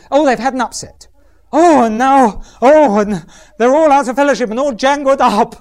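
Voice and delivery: hammed-up fretful chatter, half laughing; mock upset young woman. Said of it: "the type of thing is".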